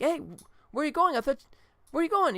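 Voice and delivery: bewildered shout at someone walking off; high surprised call after Norm. Retold or imitated. Members calling after departing Norm.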